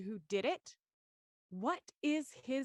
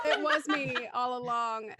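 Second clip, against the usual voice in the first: laughing confession; 6 dB louder than usual. Acted. Host joins joke laughing.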